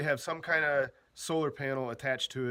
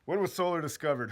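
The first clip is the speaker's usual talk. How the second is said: usual speaking voice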